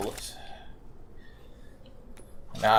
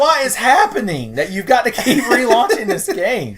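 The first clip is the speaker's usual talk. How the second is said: exasperated yell; 11 semitones higher, 6 dB louder than usual. Real, not acted. Mock complaint to viewers.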